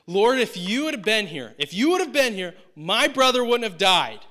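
loud, high-pitched upset protest. Acted. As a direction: cry out in anguished accusation. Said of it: "kind of, like, upset tone here".

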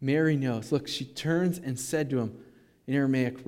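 ordinary delivery

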